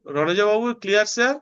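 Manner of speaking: normal talking voice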